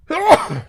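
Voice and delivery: sudden sharp sneeze; real allergy sneeze; loud sneeze. Real, not acted. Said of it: "I'm sneezing here".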